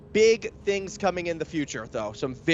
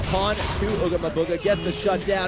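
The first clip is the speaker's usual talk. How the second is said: live play-by-play; 3 semitones higher, 3 dB louder than usual. Real, not acted. Caster calling the final fight as the base falls, excited play-by-play. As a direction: call the fight fast and loud as it unfolds.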